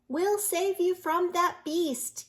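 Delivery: a brave rescuer's promise; yelled. A narrator's reading. Mister Cat promising the wolf help, slow heroic call. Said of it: "yelled Mister Cat".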